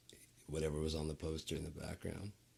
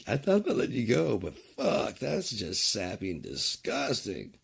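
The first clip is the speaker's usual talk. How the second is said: mock disgust; 5 semitones higher, 6 dB louder than usual.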